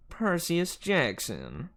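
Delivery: high, very slow Southern drawl; Southern accent. A narrator's reading. Fury teacher confronting the hero, Southern accent. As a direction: draw the name out in a Southern accent. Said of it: "in the accent that was definitely".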